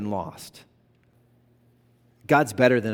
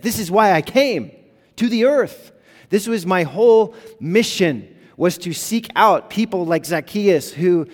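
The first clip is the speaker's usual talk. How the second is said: higher, louder preaching at full force; passionate proclamation; 8 semitones higher, 8 dB louder than usual. Acted. Preacher voicing Jesus to the congregation, loud and high. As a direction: proclaim it like it's the whole point.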